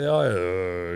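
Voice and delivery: low from the bottom of the throat. Shown as a demonstration. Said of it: "end up talking like this".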